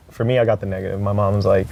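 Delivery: normal talking voice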